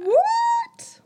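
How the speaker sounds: very high, loud disbelief; stunned high-pitched outburst; astonished shriek. Real, not acted. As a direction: squeal it in amazement. Astonished by the sighting dates.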